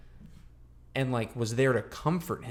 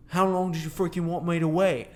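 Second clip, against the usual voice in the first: higher, louder put-on British accent; 7 semitones higher, 5 dB louder than usual. Footballer from Ted Lasso justifies his hookup, in a put-on British accent. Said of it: "That was a great accent".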